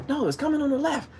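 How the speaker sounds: high snappy correction from the boater; mock boater. Boater correcting the angler about the side, high mock snap.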